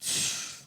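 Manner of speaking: long, voiceless exhale; imitated sigh. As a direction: mimic a clerk's wordless huff of breath. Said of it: "He went".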